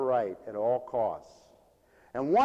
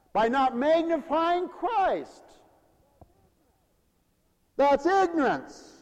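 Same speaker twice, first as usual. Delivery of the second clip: higher, slow and deliberate; slow preached emphasis; 13 semitones higher, 5 dB louder, slower than usual. Acted. Preacher closing the point, slow ringing emphasis. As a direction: lean on each word.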